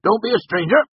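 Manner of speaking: high, loud country welcome; calling over. A narrator's reading. Cobb inviting the newcomer to the bar, calling. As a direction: urge him over with a shout. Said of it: "Cobb called to him".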